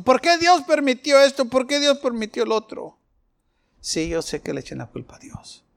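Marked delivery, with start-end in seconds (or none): 0.0-3.0 high, louder whining why to God; mock complaint. People blaming God, high mock complaint. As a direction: blame God in a pleading whine.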